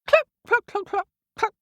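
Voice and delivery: loud high hen clucking; high squawking clucks. Acted. The cockerel clucking at the animals, high and squawky. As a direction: cluck like a startled chicken. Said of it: "said the cockerel".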